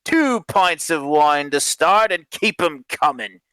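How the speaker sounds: high, slow, boisterous drawl; drunken, stretched-out bluster; dismissive drunk. Acted. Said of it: "waves away".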